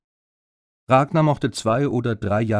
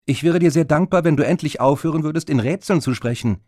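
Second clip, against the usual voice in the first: higher and louder, losing patience; fed up; 6 semitones higher, 5 dB louder than usual. Acted. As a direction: snap at him to stop the riddles, slowing on each denial. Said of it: "Lucy wurde es jetzt zu dumm".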